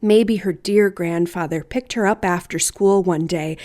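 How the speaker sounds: plain reading voice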